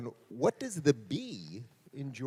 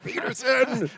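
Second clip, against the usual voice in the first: higher, louder, playful call of the name; amused exclamation; 10 semitones higher, 8 dB louder than usual. Real, not acted. Host reacting to a loophole answer, amused exclamation. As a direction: call his name out in mock protest.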